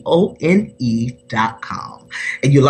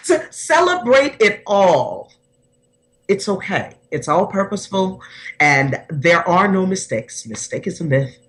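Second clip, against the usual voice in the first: normal talking voice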